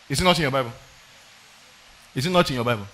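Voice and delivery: ordinary talk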